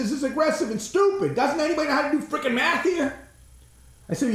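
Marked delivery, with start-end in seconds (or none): 0.0-3.1 higher, incredulous rant; scold, incredulous and higher; exasperated outburst. Retold or imitated. To the lady about management's math, exasperated.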